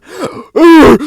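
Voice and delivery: high loud hammed-up gasp; mock gasp. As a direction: gasp like a cartoon. Mock gasp before cannon quote.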